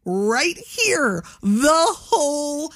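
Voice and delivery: high, very slow, drawn-out reply; exasperated retort. Retold or imitated. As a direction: stretch every word in mock exasperation. Retelling her exasperated answer to him.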